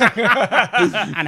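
laughing. Real, not acted. Laughing while pitching the joke.

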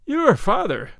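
high, loud scornful echo; mock her threat; sneering. A narrator's reading. Captain mocking the señorita's father, sneering. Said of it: "he sneered".

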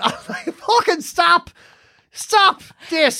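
high, drawn-out plea; mock exasperation. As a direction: beg a dramatic mum to give over. Exasperated child stopping mother.

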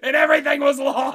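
high, loud wail; shouted lament; anguished outburst. Real, not acted. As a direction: cry it out in exasperation. Wailing about lost recording.

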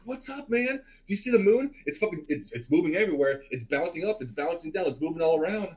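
high-pitched, wide-eyed tripping rambling; tripping friend. Retold or imitated. Tripping Richard tells his visitor about the moon, retold amazement. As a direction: imitate someone amazed by a moving moon.

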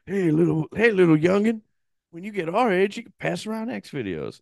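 put-on high-pitched old-timer voice; old man. Old men talking to a kid, high mock old-timer voice. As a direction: play a creaky old man advising a youngster. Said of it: "like, old men".

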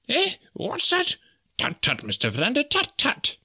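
high-pitched fussy professor; startled, tutting professor. A narrator's reading. Professor reproving his companion, high and fussy. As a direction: squeak in alarm, then tut primly.

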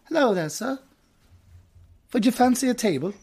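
high-pitched mock British hostess. Host as hostess seating a customer, in a mock British accent. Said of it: "She's British".

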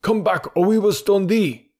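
high, threatening mob shout; crowd shouting. A narrator's reading. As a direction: call out the threat as an angry crowd. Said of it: "shouted the crowd".

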